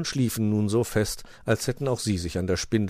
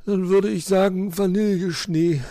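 sleepy, high voice slowing down; yawning; 11 semitones higher, 5 dB louder than usual. A narrator's reading. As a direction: answer drowsily through a yawn. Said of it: "begleitete es von einem erneuten Gähner".

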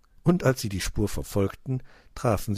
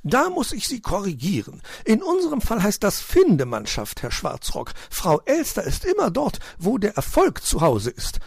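very high, self-important magpie lady; haughty; 13 semitones higher, 5 dB louder than usual. A narrator's reading. Magpie lady correcting Herr Schwarzrock, haughty. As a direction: correct him loftily, pitched way up. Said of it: "sagte sie überheblich".

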